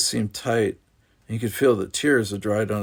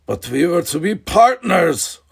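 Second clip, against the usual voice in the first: much higher, louder shrill protest; shrill with emotion; 8 semitones higher, 8 dB louder than usual. Acted. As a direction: cry out betrayed at your father. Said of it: "his voice shrill with emotion".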